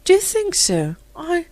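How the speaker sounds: high, startled question; surprised. A narrator's reading. Rosemary surprised by Philip's praise, startled. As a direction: gasp the word in disbelief. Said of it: "so surprised that she blushed".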